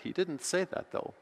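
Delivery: normal talking voice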